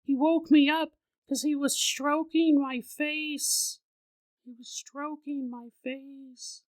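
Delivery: put-on high, slow, fading child's voice; a toddler tells something creepy; slow, high child voice, fading to a hush; eerie little girl. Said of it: "She goes".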